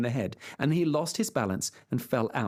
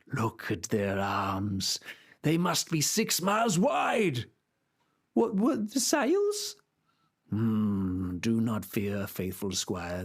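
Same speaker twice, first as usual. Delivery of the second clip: put-on deluded old knight; slower than usual. Knight describing giants to his squire, slow and grave. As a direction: pronounce it with grave certainty. Don Quixote's voice.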